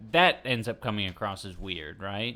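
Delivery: normal talking voice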